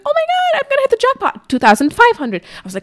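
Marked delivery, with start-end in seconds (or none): 0.0-1.5 much higher thrilled squeal; giddy high squeal at the numbers; excited squeal. Retold or imitated. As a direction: squeal as the jackpot nears. Watching earnings climb.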